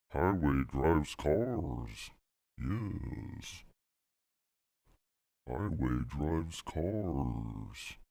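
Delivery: slow, dragged-out processed voice; voice effect. Pretend. Comedian repeating a Soviet highway joke, slow effected voice. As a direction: drag it out through a voice effect. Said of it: "use a different effect for it".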